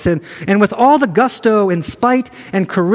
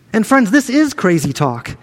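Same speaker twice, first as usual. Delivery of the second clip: ordinary talk, nothing marked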